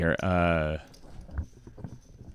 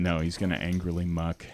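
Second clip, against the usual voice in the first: ordinary talk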